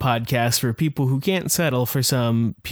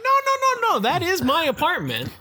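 far higher, pleading insistence; shrill denial. Retold or imitated. Insisting his hole is an apartment, squealing denial. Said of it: "I'm like".

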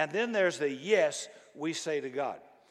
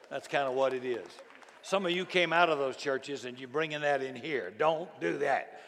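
ordinary talk

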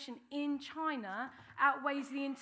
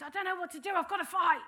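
higher, louder rush to fight; adrenaline panic; 6 semitones higher, 8 dB louder than usual. Retold or imitated. Peter in the garden deciding to fight, panicked higher and louder. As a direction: blurt it out on a sudden surge.

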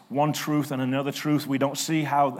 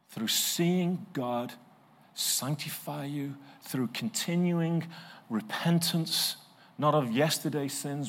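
ordinary talk

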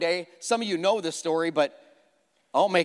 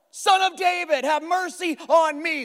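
crying out louder; 10 semitones higher, 4 dB louder than usual. A narrator's reading. Beggar crying out louder to Jesus, high desperate plea. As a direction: cry out all the more. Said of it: "he cried out all the more".

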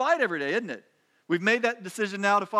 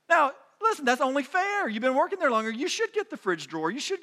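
raised, punchy mock insistence on fairness; mock indignation; 6 semitones higher than usual. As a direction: sell the sarcasm as if it's obvious. Ironic take on workplace seniority.

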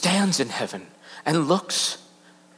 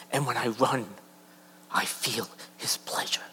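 hushed breathy whisper; awed whisper; 6 dB quieter than usual. Acted. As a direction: breathe it like a secret. Liddell's quote, whispered.

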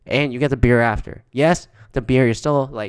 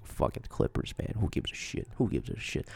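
hushed who-cares aside under the breath; muttered under the breath like nobody should hear; dismissive whisper; 3 semitones lower, 14 dB quieter than usual. Retold or imitated. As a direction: whisper it, shrugging the game off. Shrugging off the Clippers game.

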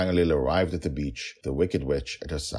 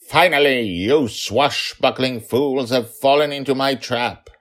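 witch voice; 10 semitones higher, 9 dB louder than usual. Acted. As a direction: savor the trap like a wicked witch. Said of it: "The Wicked Witch said".

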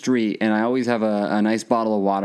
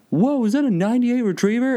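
much higher, gushing over the dog; mock excited stranger; 13 semitones higher than usual. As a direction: squeal with delight at the retriever. The guy admiring the dog.

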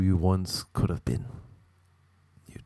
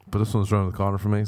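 ordinary delivery

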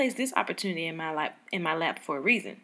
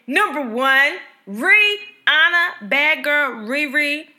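loud, high, stretched-out name reveal; big drawn-out hype; hyped announcement; 6 semitones higher, 8 dB louder, slower than usual. Real, not acted. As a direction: announce the winner with full fan excitement. Announcing her top pick.

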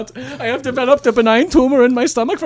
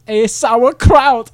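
slow punchline; 6 dB louder, much slower than usual. Acted. Comic punchline delivery.

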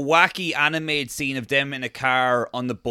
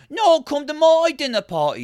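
high, louder rival invitation; insistent mock host; 12 semitones higher, 6 dB louder than usual. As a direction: outbid another host in a high put-on voice. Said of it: "It was a lot of just like".

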